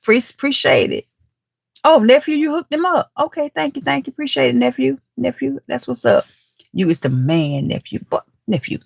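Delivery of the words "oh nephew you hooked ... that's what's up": delighted thanks. Real, not acted. Thanking nephew who helped.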